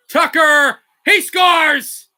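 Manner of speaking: quick excited burst; excited call. Real, not acted. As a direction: punch out the score. Basket called live.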